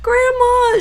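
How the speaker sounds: very high, loud retold cry to grandma; excited exclamation. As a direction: exclaim it as if overcome by her joy. Retold reaction to overjoyed grandmother.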